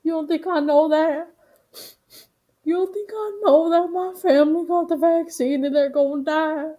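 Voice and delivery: loud, high-pitched wailing imitation; hammed-up hysterical sobbing; mock crying. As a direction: wail it like a bawling dad, badly faked. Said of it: "this dad is, like, crying".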